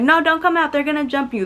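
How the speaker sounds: high-pitched mock warning; retold warning. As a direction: imitate someone urgently calling a person off. Acted warning in street quarrel.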